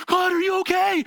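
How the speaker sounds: high, loud imitated yell of worry; dad's alarmed shout. Dad running up to his son in the tree, alarmed shout. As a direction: call up urgently as a frightened dad. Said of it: "he's like".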